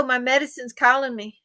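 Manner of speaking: ordinary talk, nothing marked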